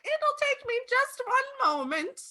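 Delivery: put-on high, sing-song old lady. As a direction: chirp it like a sweet old woman.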